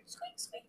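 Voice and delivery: high, whispered squeak squeak; squeaky sneaker noise, whispered; imitated sneaker squeak. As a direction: mimic shoes squeaking on the court, breathy and high. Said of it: "It's all here".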